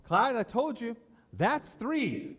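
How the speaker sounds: high, cold final count; stern wife final warning. Retold or imitated. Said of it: "And she's all".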